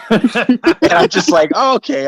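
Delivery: high, laughing, slow surprised "oh, okay"; taken aback. Retold or imitated. Hearing Mike offer to bury the guy, amused surprise. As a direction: caught off guard and amused.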